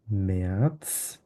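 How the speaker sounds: ম্যাথস drawn out as "me-ats"; umlaut as E. Shown as a demonstration. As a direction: slowly model ম্যাথস with an E vowel and TS ending. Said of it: "এটা হচ্ছে এ উচ্চারণ করি". (ম্যাথস ধীরে "মেআৎস")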